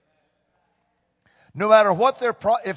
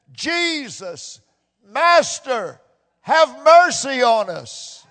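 loud, slow plea for mercy, higher; pleading cry; 6 semitones higher, 5 dB louder, much slower than usual. Retold or imitated. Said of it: "They lifted up their voices".

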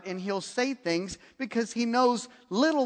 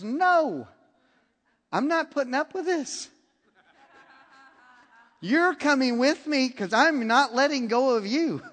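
higher, firm, no arguing; defiant refusal; 6 semitones higher, 4 dB louder than usual. Retold or imitated. Mother refusing Elisha's staff, higher and unyielding. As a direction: plant your feet and refuse.

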